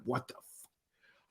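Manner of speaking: slow, soft stunned aside; under his breath in disbelief; inner thought. Retold or imitated. Thinking to himself at the gangrenous foot, slow and hushed. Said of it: "I'm like, in my mind".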